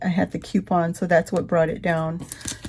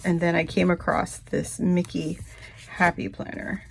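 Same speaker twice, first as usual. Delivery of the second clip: ordinary delivery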